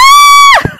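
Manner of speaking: high loud laugh after a fright; relieved laugh. Real, not acted.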